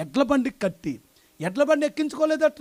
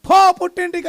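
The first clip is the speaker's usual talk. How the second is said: higher, louder curt order to go; mock angry order; 7 semitones higher, 9 dB louder than usual. Husband sending his sick wife back to her mother's house, shouting. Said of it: "అని చెప్పి పంపించేస్తామే".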